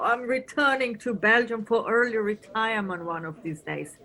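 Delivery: ordinary talk, nothing marked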